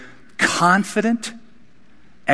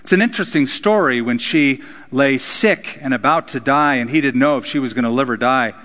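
usual speaking voice

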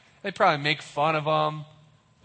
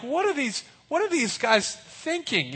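much higher, disbelieving; mock the screaming men; baffled; 10 semitones higher than usual. You on the wall watching men scream, much higher. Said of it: "And you're like".

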